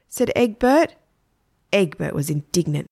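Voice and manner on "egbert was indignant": plain reading voice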